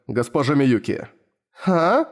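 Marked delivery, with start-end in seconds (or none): 1.7-2.1 sharp high gasp of shock; startled cry. Acted. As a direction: cry out in surprise. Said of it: "вскрикнула Маюми".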